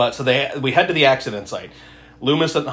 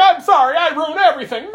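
mock little girl; 12 semitones higher, 4 dB louder than usual. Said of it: "She's like".

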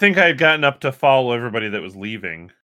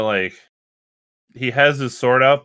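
ordinary delivery

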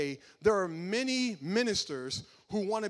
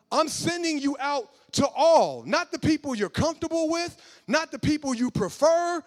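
high, loud preacher's cry building to a shout; preaching at full force; 8 semitones higher, 7 dB louder than usual. Real, not acted. Preacher to the congregation, full-force loud preaching. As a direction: drive it home from the pulpit, all means all.

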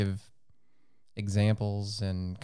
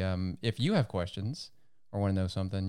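usual speaking voice

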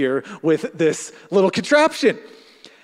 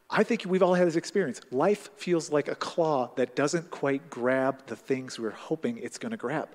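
ordinary delivery

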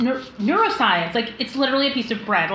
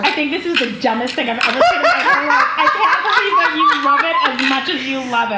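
laughing while teasing; 2 semitones higher, 4 dB louder than usual. Real, not acted. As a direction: talk through laughter. Teasing friend about caviar videos.

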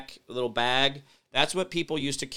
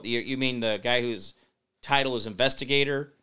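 usual speaking voice

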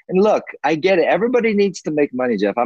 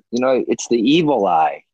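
ordinary talk

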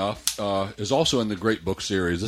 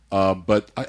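usual speaking voice

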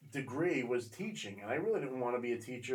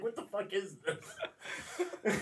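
laughing disbelief; 7 semitones higher, much slower than usual. Retold or imitated. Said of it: "and it's like".

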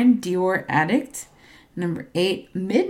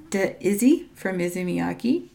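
normal talking voice

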